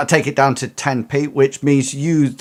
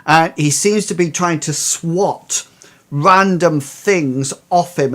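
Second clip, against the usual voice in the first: usual speaking voice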